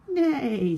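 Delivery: high loud neigh; whinny like a horse. A narrator's reading. Horse's line.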